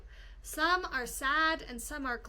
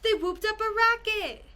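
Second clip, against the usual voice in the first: whooping excitement; 7 semitones higher, 6 dB louder than usual. A narrator's reading. Noisy celebration narrated.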